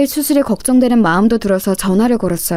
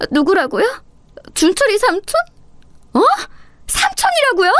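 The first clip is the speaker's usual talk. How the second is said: shocked disbelief; 9 semitones higher than usual. Acted. Shocked to hear uncle's voice.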